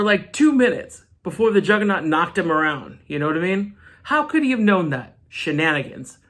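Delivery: ordinary delivery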